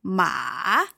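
Ma dipping down then up, third tone. Shown as a demonstration. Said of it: "third tone is going down and up".

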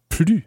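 "plus" with the final S left silent. Shown as a demonstration. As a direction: say "plus" without sounding its S. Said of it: "because of the S being silent".

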